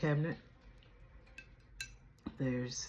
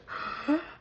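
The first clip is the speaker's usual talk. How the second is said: gasp; 13 semitones higher, 4 dB louder than usual. Acted. Sudden gasp on camera start.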